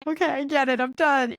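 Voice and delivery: high-pitched retold surrender; tearful giving in. Passenger in tears to the Uber driver, overwhelmed surrender. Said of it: "I was just in tears".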